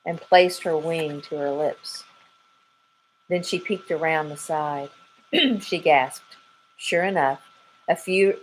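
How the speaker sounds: usual speaking voice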